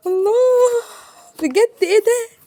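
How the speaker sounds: awed unboxing gasp; delighted squeal. Real, not acted. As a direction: gasp at what's inside. Opening the box.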